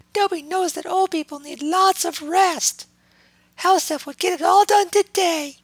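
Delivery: very high self-satisfied elf voice; smug house elf. A narrator's reading. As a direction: gloat like a proud little elf. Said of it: "he said smugly".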